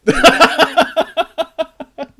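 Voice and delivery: high, loud burst of cackling; long real belly laugh, pitched high; big laugh. Real, not acted. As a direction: crack up loudly in a high pitch. Laughing at the co-host's greeting.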